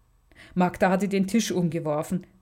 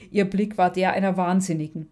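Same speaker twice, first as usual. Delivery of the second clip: ordinary delivery, nothing marked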